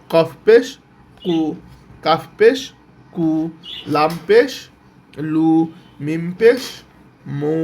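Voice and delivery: each পেশ lifted and stressed, then a separate u syllable like মু; spelling drill. Shown as a demonstration. Teacher showing learners how to read pesh, rhythmic spelling drill. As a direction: chant letter plus পেশ rising, pause, drop to the syllable. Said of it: "এইভাবেই পড়তে হবে".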